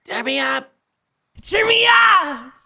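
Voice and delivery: high, slow yelling call; loud yell. Real, not acted. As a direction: yell out for a revive. Friends yelling to be revived.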